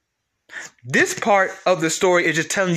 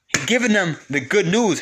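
ordinary talk, nothing marked

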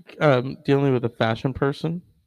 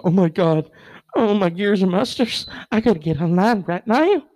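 put-on high, excitable Southern accent; hammed-up Southern caller in a rush; 7 semitones higher than usual. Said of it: "he doesn't have a Southern accent".

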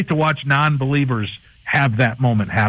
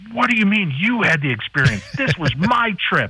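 mock complaint, pitched much higher; imitating indignant wives; 8 semitones higher than usual. Host imitating the wives upset they missed the experience, pitched much higher. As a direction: whine it up high like a jealous wife. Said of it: "the wives come down and they're like".

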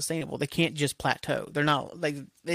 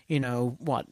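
usual speaking voice

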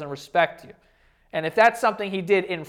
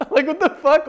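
high, loud laughing disbelief; shocked, laughing what the fuck; incredulous laugh; 9 semitones higher, 6 dB louder than usual. Real, not acted. Reacting to a baffling claim.

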